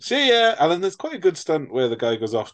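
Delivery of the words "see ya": taunting farewell. Retold or imitated. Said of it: "he's very much like".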